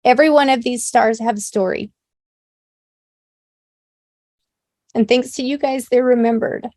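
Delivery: real tears while honouring people's stories; tearful. Real, not acted. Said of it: "Sorry for all the tears".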